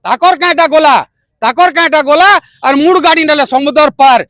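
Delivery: measured, rhythmic verse; protest poem recitation. Acted. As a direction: recite with a steady beat, rallying your people. (ଛନ୍ଦରେ କବିତା ଆବୃତ୍ତି)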